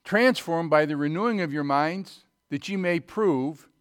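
emphatic sermon reading; scripture reading. Acted. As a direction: lean into the verse.

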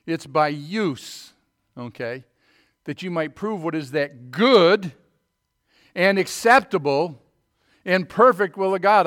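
full-force Bible preaching. Acted. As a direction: ring it out over the congregation.

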